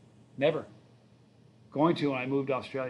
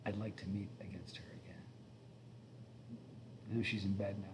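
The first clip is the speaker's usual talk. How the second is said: whisper; 6 semitones lower, 14 dB quieter than usual. Real, not acted. Quiet aside about sleeping partner.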